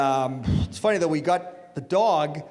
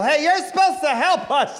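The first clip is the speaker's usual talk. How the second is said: mock yell; 6 semitones higher, 4 dB louder than usual. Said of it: "Some lady's gonna yell".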